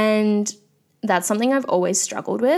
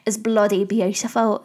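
bad Essex accent. Host complimenting people from Essex in a bad Essex accent. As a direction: lay on a clumsy Essex twang. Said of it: "that was not a good accent".

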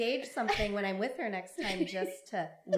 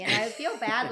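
usual speaking voice

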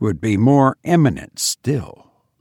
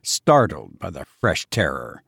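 plain reading voice

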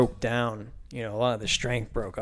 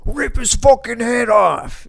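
much higher and louder, slow hammed-up yell; mock fan yell; 11 semitones higher, 9 dB louder, slower than usual. Rowdy Steelers fan at a preseason game, hammed-up yelling. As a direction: bellow it like a rowdy fan in the stands.

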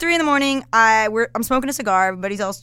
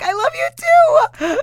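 gushing squeal; 8 dB louder, faster than usual. Retold or imitated. Storyteller relives goodbye to the comedian, gushing squeal. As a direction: squeal it out, smitten.

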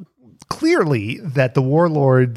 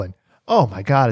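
ordinary talk, nothing marked

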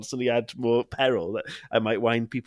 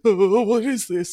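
higher, slow, a bit louder dog voice; voicing the scared dog; 10 semitones higher, 4 dB louder, slower than usual. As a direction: give the frightened dog a silly voice. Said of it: "She's like".

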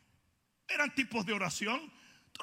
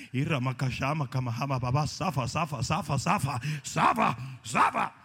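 mock incantation; 8 semitones lower than usual. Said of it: "mock incantation".

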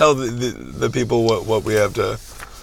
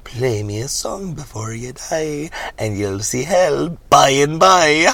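menacing singsong leprechaun; leprechaun threat; 4 semitones higher, slower than usual. Acted. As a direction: savor the threat like a wicked imp. Leprechaun's sung-like threat to the reverend.

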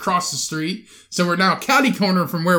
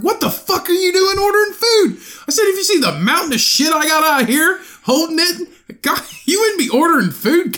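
retold angry yelling; 12 semitones higher, 3 dB louder than usual. Retold yelling at Cody on phone.

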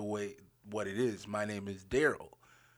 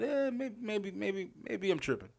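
doubtful inner voice; 9 semitones higher, 6 dB quieter than usual. Retold or imitated.

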